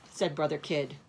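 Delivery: ordinary delivery, nothing marked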